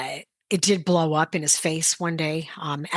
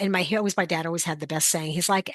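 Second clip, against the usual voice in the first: ordinary talk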